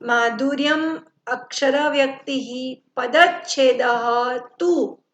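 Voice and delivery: માધુર્યમ, અક્ષર વ્યક્તિ, પદચ્છેદ, તુ each set apart by pauses; words separated. Shown as a demonstration. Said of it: "આ છૂટા પડ્યા".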